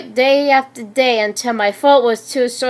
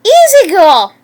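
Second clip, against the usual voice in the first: loud, high attempt to steady an animal; urgent call; 7 semitones higher, 6 dB louder than usual. A narrator's reading. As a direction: call out to calm the beast, pitched up. Calming a growling creature, urgently.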